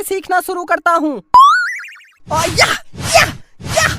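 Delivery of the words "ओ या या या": high-pitched mock kung fu cries; karate yells. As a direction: yell like a movie fighter. Boy practising karate moves.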